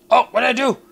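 loud, high flustered outburst; high-pitched alarmed confusion; startled panic. Real, not acted. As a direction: yell in sudden alarm at your own mistake. Startled by own action in game.

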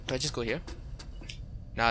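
ordinary talk

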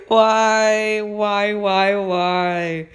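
high, drawn-out lament, gradually easing down; dismayed wailing. Real, not acted. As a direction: wail each word in comic despair. Laments getting the unwanted pet.

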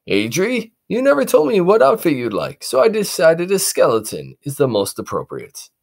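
high, jolly father; proud dad with gifts; dad surprising his kids; cheerful dad. Acted. Dad to son.

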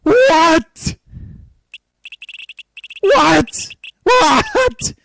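high, loud disbelieving What?; shocked. Real, not acted. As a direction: screech each What? in disbelief. Shocked by tablet result.

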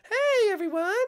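very high squeaky hey; high cartoon call; silly shout-out. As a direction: give the spot away in a high squeak. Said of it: "I'm gonna blow our hiding spot".

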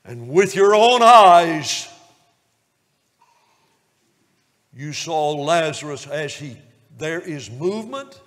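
fervent revival preacher wonder; preaching at full force. Acted. Preacher placing the congregation at the raising of Lazarus.

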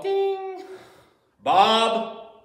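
high sung bell ding-bong; playful bell chime noise; mock bell ding. Imitated bell sounds.

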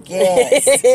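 real excited cheer. Real, not acted. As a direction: celebrate the win. Cheering her game.

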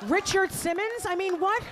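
high-pitched incredulous retort; exasperated. Retold or imitated. Daughter to her forgetful mother, exasperated. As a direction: demand it in mock exasperation.

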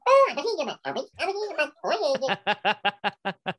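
very high, squeaky squirrel chatter, slowing at the end; the squirrel voice Mark asked for, squeaky and high; squirrel language. Shown as a demonstration. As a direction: chitter it out like a squirrel. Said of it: "And I went".

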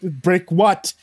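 high, loud choked question; strangled gasp. A narrator's reading. Servant questions the lord choking him, gasping. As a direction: gasp it out through a crushed throat. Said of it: "I managed to gasp".

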